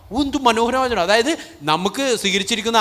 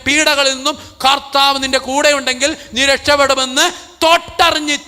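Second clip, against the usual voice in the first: high, loud testimony at full force; fiery preaching; 8 semitones higher, 6 dB louder than usual. Acted. Priest testifying about his healing to the congregation, at full force. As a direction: proclaim it to the whole hall.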